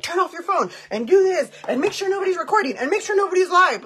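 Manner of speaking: high, loud, frantic bossing around; bossy rules. Retold or imitated. Friend retelling her old self, mock frantic bossy orders. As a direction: rattle off orders like a nervous control freak.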